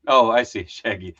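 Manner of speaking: normal talking voice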